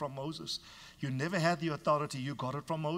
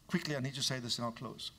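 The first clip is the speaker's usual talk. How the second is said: normal talking voice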